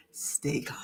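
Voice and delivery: soft hushed aside; whisper. Real, not acted. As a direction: whisper it to herself.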